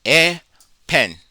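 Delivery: "a" loud and high, then "pen"; split into syllables. Shown as a demonstration. Teacher splits the word into its two syllables, "a" loud and high. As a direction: pop "a" high before "pen". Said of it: "two syllables".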